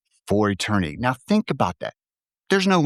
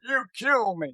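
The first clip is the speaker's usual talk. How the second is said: high cracking Alf voice; Alf impression; 11 semitones higher, 3 dB quieter, slower than usual. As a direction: squeak it out like Alf. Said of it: "a really good Alf, uh, impression".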